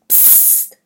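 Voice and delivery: sharp voiceless psst; hissed shush. A narrator's reading. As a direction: hush them urgently with a hiss. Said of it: "zischt das Indianermädchen". (zischendes Psst)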